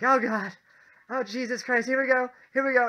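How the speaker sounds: high, loud mock terror; imitating panicked roommate. Roommate waiting at the top for the drop, mock terror. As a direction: brace for the drop in exaggerated panic. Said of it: "And he's like".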